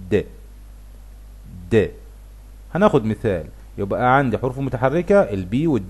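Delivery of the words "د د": د said as a short voiced d; just a plain short d; the d sound. Shown as a demonstration. Teacher showing the d ending to learners. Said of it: "بنطقها".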